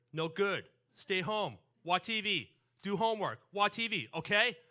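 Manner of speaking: imitating parents' loud, clipped-off refusals; parents' broken record. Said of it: "But like a broken record".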